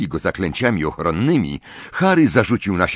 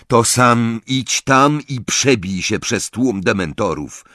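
ordinary delivery, nothing marked

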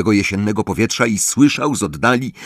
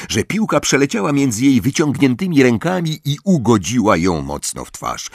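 usual speaking voice

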